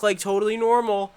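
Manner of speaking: ordinary delivery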